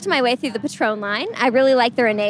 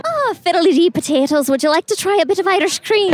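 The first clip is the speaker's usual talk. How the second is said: bad Irish accent; 6 semitones higher than usual. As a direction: ham up a bad Irish brogue. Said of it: "use a really bad Irish accent".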